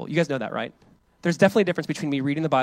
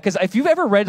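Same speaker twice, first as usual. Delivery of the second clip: ordinary talk, nothing marked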